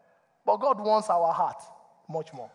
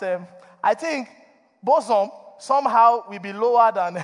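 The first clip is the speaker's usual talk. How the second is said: usual speaking voice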